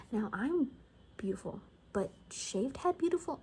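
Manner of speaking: ordinary talk, nothing marked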